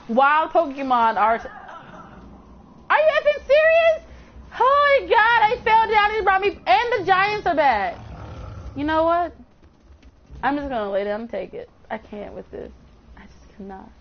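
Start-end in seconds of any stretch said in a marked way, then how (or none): 2.9-8.0 high-pitched cry of disbelief; exasperated disbelief. Real, not acted. Fresh setback in game. 10.4-13.9 low, soft, resigned; defeated. Real, not acted. Giving up on the fight.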